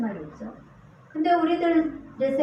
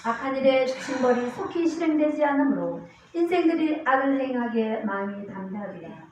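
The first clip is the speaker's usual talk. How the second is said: raised, declamatory Bible reading; scripture reading; 4 semitones higher, 4 dB louder than usual. Acted. Preacher reading verses to the congregation, declamatory.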